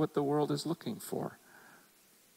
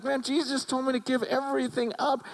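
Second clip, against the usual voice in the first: mock sad ruler; 10 semitones higher than usual. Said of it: "And he's so sad".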